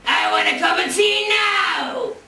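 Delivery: loud, slow bellow; demanding giant shout. Acted. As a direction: roar the demand up the stairs.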